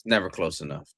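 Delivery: ordinary talk, nothing marked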